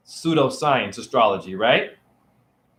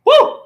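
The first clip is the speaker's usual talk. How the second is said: short, high, loud "woo!"; triumphant whoop; 7 dB louder than usual. Real, not acted. Debunker crowing at the doubters, triumphant whoop. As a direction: let out a sudden high whoop.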